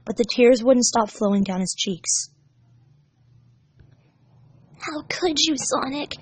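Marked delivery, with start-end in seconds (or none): 4.8-6.2 hurt inner thought. Acted. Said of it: "he thought to himself".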